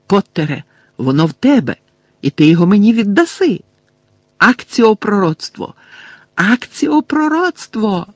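loud command climbing very high; frantic demand. A narrator's reading. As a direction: escalate to a desperate high cry. Enraged, panicked villain demands the prophecy. (несамовита гучна вимога)

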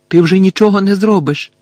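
loud insistent warning; urgent. A narrator's reading. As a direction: firmly call him back from danger. Lupin urgently restraining Harry.

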